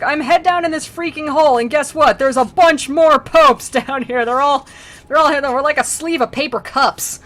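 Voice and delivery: high, loud comic complaint; hammed-up indignant hollering; mock exasperated rant. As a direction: shout up from the hole, half laughing. Upside-down pope's acted rant.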